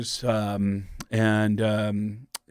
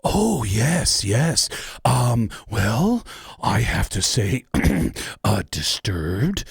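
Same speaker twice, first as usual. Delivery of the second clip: put-on slow, measured, formal young man; James from Love on the Spectrum; much slower than usual. Interviewer asks his favorite metal band, slow formal James voice. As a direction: answer like James, careful and proper. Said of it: "I'll answer it as James from Love on the Spectrum".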